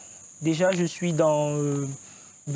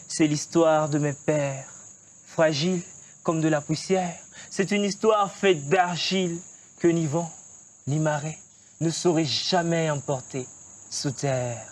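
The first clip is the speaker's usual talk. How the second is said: slow closing refrain; 5 semitones lower than usual. Shown as a demonstration. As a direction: let the last refrain fade and settle. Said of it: "Tu nous déclames un texte?". (refrain final lent, bas et posé)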